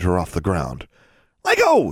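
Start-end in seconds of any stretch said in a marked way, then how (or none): 1.4-1.9 sharp, much higher cry; shout. Acted. Girl grabbed from behind, shouting to be let go. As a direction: yell it as you struggle free. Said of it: "she shouted".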